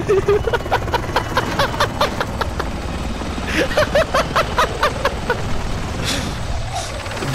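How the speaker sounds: high shrieking laugh; hysterical laughter. Real, not acted. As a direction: laugh wildly in triumph. Wild laughter after the win.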